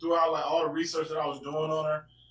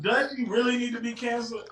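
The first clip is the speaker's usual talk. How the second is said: incredulous; 8 semitones higher than usual. Real, not acted. Protesting the cancel call.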